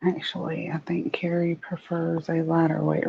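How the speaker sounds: soft unvoiced whisper. Real, not acted. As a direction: whisper it as an aside. Aside in a hushed voice.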